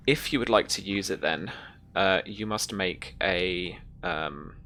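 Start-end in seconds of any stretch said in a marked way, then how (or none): none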